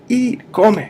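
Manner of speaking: high, loud order to eat; loud command. Shown as a demonstration. Teacher acting out telling someone to eat, loud and high. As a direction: snap it like an order at dinner. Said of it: "We say".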